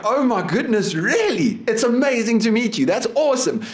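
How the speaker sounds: high-pitched, over-the-top starstruck delight; mock gushing. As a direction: gush as if meeting a celebrity, sarcastically.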